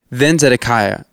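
ordinary delivery

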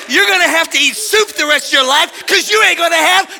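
high, loud threatening tirade; raging dad. Retold or imitated. Preacher imitates own dad threatening him, raging shout. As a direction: hammer out threats like a furious father. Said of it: "My dad said".

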